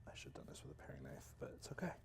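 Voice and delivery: fast hushed whisper. Real, not acted. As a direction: mutter it to yourself. Whispered self-talk.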